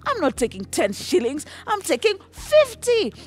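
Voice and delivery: loud, high-pitched outrage; indignant demand. Acted. Tax collector refusing ten shillings, indignant demand.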